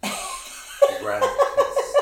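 cracking up mid-word; laughing. Real, not acted. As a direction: break into high laughter. Laughing while wrapping up.

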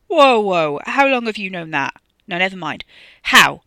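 loud, tumbling questions; urgent excitement. A narrator's reading. Wes presses Ricky for the way back, urgently excited. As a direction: demand answers, heart in throat.

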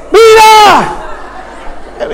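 high shouted call across a crowd. Retold or imitated. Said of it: "qué hace un boricua cuando no conoce el nombre".

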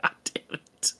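breathy whisper to himself; whispered frustrated curse. Real, not acted. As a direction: hiss it under the breath. Muttering after losing temper.

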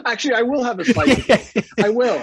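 high, loud, laughing pretend escape to cake; laughing mock cheer. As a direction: chuckle through a fake cheerful offer.

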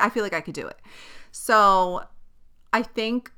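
ordinary talk

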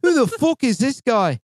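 pretend-furious question; mock outrage. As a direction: scoff at the stranger. Ranting at imagined person.

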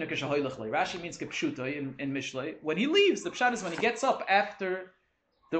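ordinary talk, nothing marked

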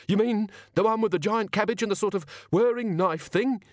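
high-pitched, anxious questioning; shuddering guard. A narrator's reading. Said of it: "The man shuddered".

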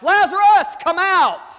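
high, loud, ringing call into the tomb; Jesus shouting into the tomb, high and loud; commanding shout. Acted. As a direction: bellow the command like you are raising the dead. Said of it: "Jesus shouts".

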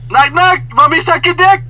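very high, loud maid call; hammed-up high-pitched door call; movie maid. Maid knocking on guest's door with crude offer, high calling voice. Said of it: "She goes".

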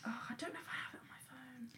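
hushed muttered aside; under-the-breath whisper. Real, not acted. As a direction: whisper it to yourself.

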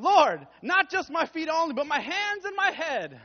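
loud, high, eager outburst; overdoing it like an extra disciple; 1 overeager Peter. Acted. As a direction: blurt it out, all in. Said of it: "Simon Peter says to him".

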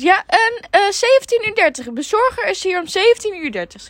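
high, loud sing-song service voice; chirpy phone politeness; customer service voice. Acted. Answering phone at pizza shop.

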